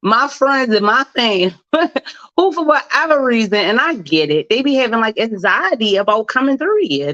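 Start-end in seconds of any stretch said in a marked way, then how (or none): none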